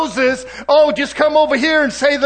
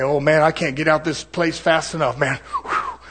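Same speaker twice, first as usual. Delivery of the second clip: lower, softer scoffing listener; mock offended listener; 6 semitones lower, 4 dB quieter than usual. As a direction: play the churchgoer ready to run. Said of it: "And you say".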